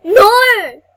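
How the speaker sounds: shouted, loud protest; real dismay. Real, not acted. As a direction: yell it at the screen. Losing ground in game.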